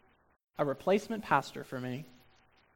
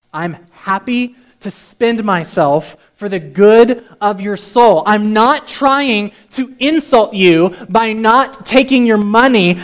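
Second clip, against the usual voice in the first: loud, raised, emphatic preaching voice; impassioned pulpit voice, pitched higher; full-force preaching; 6 semitones higher, 20 dB louder than usual. Acted. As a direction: declare it forcefully to the congregation.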